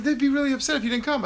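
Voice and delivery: high, fast whiny mimicry; mock whiny relatives; mocking their plea. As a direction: parrot their guilt trip in a silly voice. Mimicking family's guilt plea.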